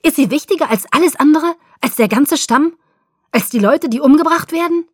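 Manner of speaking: high, loud accusing questions; heated, high-pitched outburst; furious yelling. A narrator's reading. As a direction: hurl the questions at him in rage. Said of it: "schrie er".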